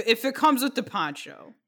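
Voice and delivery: ordinary delivery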